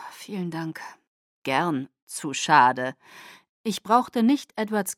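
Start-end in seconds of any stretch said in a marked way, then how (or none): 0.1-0.8 unvoiced, breathy whisper; a dry whispered thank-you. Bella whispers her thanks to Felix, coolly unvoiced. 1.4-3.0 low, mocking Felix voice; lower menacing taunt. Felix taunts Bella, low and mocking, pleased with himself.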